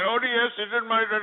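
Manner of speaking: a mocking store-clerk accent; mock Arabic accent. Host showing the caller how to play the Arabic accent. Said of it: "keep that Arabic immigrant dialect".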